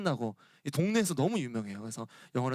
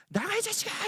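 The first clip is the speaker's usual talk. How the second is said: much higher mock yell of an angry general; mock shout. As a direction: throw him out with a hammed-up yell. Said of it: "장군이 소리를 지르는 거예요".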